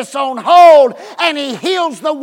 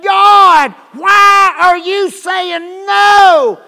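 whining; 7 semitones higher, 7 dB louder, much slower than usual. Retold or imitated. Stomping believer complaining to God.

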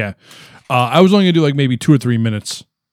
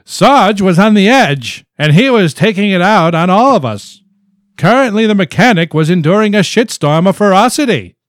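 high, loud ragtime-era reading voice; put-on ragtime voice; 8 semitones higher, 5 dB louder than usual. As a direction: read the story in a hammed-up showman voice. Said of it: "doing a voice".

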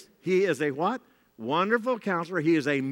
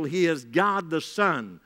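ordinary talk

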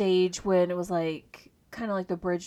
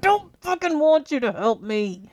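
angry shout; 9 semitones higher, 10 dB louder than usual. Retold or imitated. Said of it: "I'm like".

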